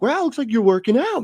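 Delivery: high-pitched gushing gym-buddy enthusiasm; overly nice compliment. Retold or imitated. Too-nice coworker complimenting someone's fitness, mock gushing. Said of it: "being too nice and be like".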